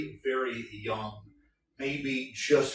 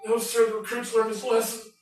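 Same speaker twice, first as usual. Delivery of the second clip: higher, meek recruit reply; 10 semitones higher than usual. Acted. Recruit declining another bout, meek. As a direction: back down politely.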